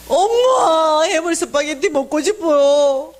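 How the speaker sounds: sobbing out a plea, with the first word cried out loud; crying daughter. Retold or imitated. A daughter wails to her mother that she wants seafood spaghetti, crying out. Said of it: "펑 울어버리는 거야".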